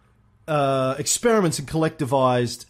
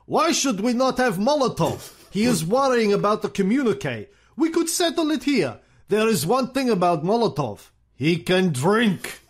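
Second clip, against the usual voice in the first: Stalin impression; 7 semitones higher than usual. Historian voicing Stalin to Churchill in a light Russian accent. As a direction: play Stalin boasting his minister can drink, in a Russian accent. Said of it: "Then he said".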